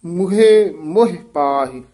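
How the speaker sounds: slow ਮੁਹੇ in two syllables, then short ਮੋਹ; careful pronunciation demo. Shown as a demonstration. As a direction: draw out ਮੁਹੇ, say ਮੋਹ quickly. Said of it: "ਇਹਦਾ ਉਚਾਰਨ".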